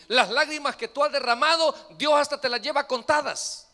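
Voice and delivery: loud, rising sermon fervor; preaching at full force. Acted. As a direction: drive it home to the congregation, loud.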